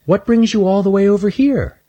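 put-on high, sweet little girl squirrel; little girl squirrel calling from behind a bench. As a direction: ask it like a curious little girl. Shelby asks.

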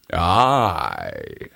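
low, fierce lion growl; lion growls yes to the hare, low. A narrator's reading. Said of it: "growled the lion fiercely".

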